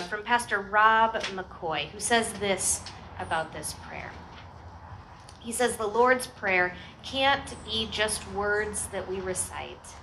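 ordinary talk, nothing marked

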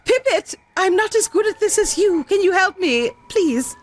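high, pleading ask for help. Acted. Pleading with another character.

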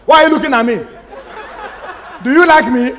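imitating a bold girl confronting a boy; mock sassy girl. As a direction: snap it like a cocky girl.

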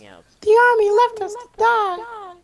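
very high, slow melodramatic cry; dramatic wail. Acted. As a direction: wail it like a tragic hero. Dramatic hammed-up line.

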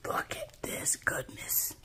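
slow, hushed whisper of delight; awed whisper. Real, not acted. Crafter whispering over a lovely handmade gift. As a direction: breathe it out over the treat. Opening craft mail in awe.

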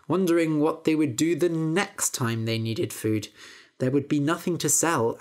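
plain reading voice

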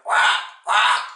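loud, harsh imitated bird squawk; two sudden squawks like a crow. A narrator's reading. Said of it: "the bird squawked".

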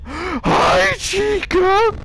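high, loud, nervous hello; startled greeting. Real, not acted. Player startled greeting Chica at the door. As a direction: greet her in terrified surprise.

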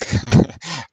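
brief amused chuckle; a quick embarrassed laugh; short laugh. Real, not acted. Speaker laughs mid-sentence.

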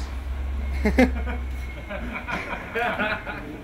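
soft real chuckle; breathy giggle; own laugh. Real, not acted. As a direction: laugh quietly at your own joke.

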